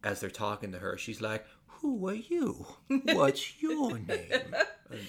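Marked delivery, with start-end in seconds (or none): 1.7-4.5 high, slow, eerie whisper-like voice; Alpha impression. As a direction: drag it out like a creepy cult leader. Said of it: "she talks a little bit like this".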